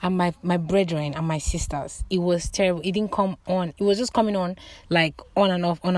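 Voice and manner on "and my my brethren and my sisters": normal talking voice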